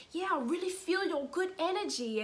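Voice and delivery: put-on Emma's British accent. Imitating Emma praising good energy, badly faked British accent. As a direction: put on a cute little British accent, hammed-up. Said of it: "in her little British accent".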